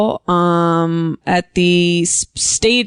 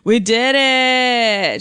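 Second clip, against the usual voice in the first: triumphant; 5 semitones higher, much slower than usual. Pretend. Celebrating the twist.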